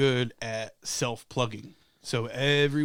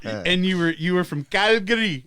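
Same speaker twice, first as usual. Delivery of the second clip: ordinary talk, nothing marked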